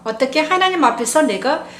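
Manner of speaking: usual speaking voice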